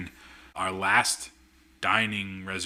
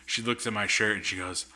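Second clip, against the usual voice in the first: usual speaking voice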